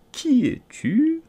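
high, slow drowsy drawl of a caterpillar; languid, sleepy caterpillar. A narrator's reading. Caterpillar lazily asks Alice who she is, languid and sleepy. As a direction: let the question drift out half asleep. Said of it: "d'une voix languissante et endormie".

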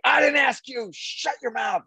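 high, furious mock yell; boss screaming. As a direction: imitate an irate boss cutting someone off. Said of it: "like, that kind of".

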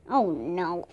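low, sinking dismay; dismayed thought. A narrator's reading. Jack dreads the horse walking off.

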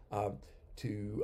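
usual speaking voice

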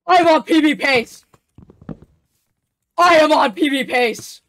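excited shout. Real, not acted. Runner hits personal best pace.

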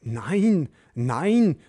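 lautes erschrockenes Nein; erschrockener Ruf. A narrator's reading. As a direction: ruf es im ersten Schreck. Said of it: "ruft ... im ersten Schreck". (Madame Gschwind ruft erschrocken Nein)

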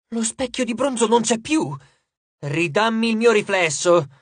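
indignant, insistent protest; outraged demand. A narrator's reading. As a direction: cry out for the stolen mirror. Narcissus demands his mirror back.

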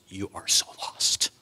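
whispered, lost and small; hushed. Acted. Preacher telling the congregation how lost they were, whispered. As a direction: breathe it like a confession. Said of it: "unfamiliar and unacquainted".

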